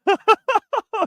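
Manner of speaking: high, manic cackle; mock villain laugh. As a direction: cackle like you've lost it. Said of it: "[name]'s already lost it".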